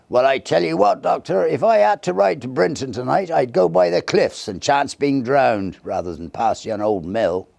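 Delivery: a rough stable hand urging the doctor; insistent groom. Acted. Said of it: "the groom".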